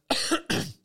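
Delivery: real coughing fit, throat caught; choking cough. Real, not acted. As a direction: cough to clear a choked throat. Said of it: "mi è andato di traverso qualcosa".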